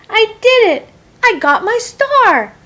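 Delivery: high, loud cry of joy; excited shout. A narrator's reading. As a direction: burst out with delight. Child's joyful success in class.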